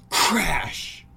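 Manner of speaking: loud, high shouted crash; sudden crash. Acted. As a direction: burst out the crash to startle listeners. Imitates window breaking.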